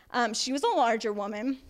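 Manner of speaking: ordinary talk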